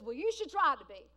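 loud, emphatic mock admonishment; retold parental comeback. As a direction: fire back loudly as the advising parent. Dad's retold comeback to child.

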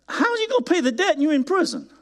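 high, fast, disbelieving; incredulous question. Shown as a demonstration. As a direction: ask it as if it were absurd. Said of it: "that's how ridiculous".

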